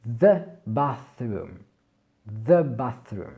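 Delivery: the bathroom with a gentle voiced th; th sonore doux. Shown as a demonstration. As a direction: buzz the th lightly. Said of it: "la langue légèrement entre les dents".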